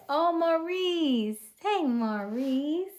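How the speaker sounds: high, loud call of the name; excited greeting. Real, not acted. Greeting a viewer excitedly, high and loud. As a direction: sing out the name.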